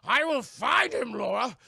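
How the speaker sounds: high, loud, slow screamed vow; screaming threat. Retold or imitated. Zod swearing to Laura he'll find her son, slow high scream. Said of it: "he's just screaming it".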